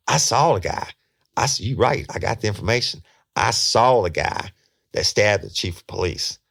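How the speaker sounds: high, loud excited outburst; acting out someone's eager revelation; imitated excitement. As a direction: blurt it out like an overexcited witness. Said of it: "He's so excited".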